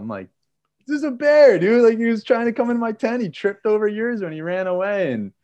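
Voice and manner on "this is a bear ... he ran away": loud, very high-pitched exclamation; retold frantic insistence. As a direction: re-enact desperately convincing a disbelieving friend. Acted quarrel, insisting on the bear.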